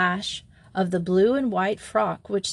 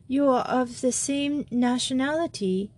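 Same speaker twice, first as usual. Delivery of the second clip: put-on young French girl; 5 semitones higher than usual. A narrator's reading. Simone confides, tearful.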